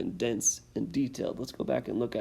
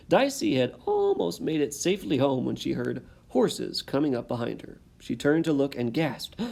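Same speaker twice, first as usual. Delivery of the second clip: lively read-aloud, louder with rising tension; story narration; 2 semitones higher, 4 dB louder than usual. A narrator's reading. Teacher reading the exciting last page to students, dramatic storytelling. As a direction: build the chase for the listening kids.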